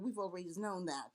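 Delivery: normal talking voice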